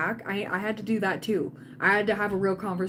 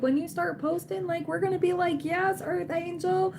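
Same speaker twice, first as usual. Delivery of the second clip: cheering on; 9 semitones higher than usual. Real, not acted. Cheering viewer on.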